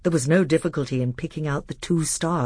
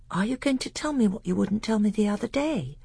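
put-on higher, eager boy's voice; young prince voice; 7 semitones higher than usual. A narrator's reading.

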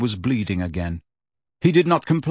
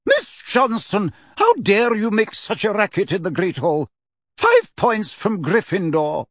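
stern Scottish teacher; 8 semitones higher than usual. Acted. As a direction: rebuke her sharply in the hall. McGonagall scolds Angelina.

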